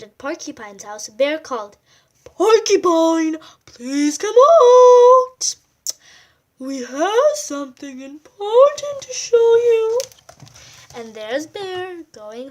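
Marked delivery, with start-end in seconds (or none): 2.2-10.0 high, slow, loud call to come out. A narrator's reading. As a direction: Bear calling out loud and slow for Porcupine. Bear calls Porcupine to come out.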